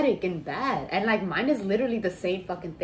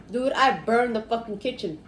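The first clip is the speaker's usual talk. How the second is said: ordinary talk, nothing marked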